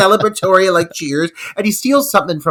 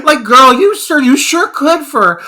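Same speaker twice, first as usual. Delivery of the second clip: louder, higher, laughing; laughing tease; 10 semitones higher, 8 dB louder than usual. Real, not acted. Host teasing the woman on screen, laughing. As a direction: tease her through laughter.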